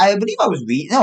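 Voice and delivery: ordinary talk, nothing marked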